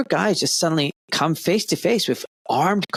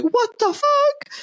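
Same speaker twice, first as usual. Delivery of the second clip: very high, slow, stretched-out disbelief; bewildered; much slower than usual. Retold or imitated. The man reacting to the cop's orders in disbelief. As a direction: squeal it out in total confusion. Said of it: "This guy is, like, going".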